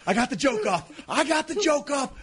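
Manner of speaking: excited. Retold or imitated. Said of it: "he goes".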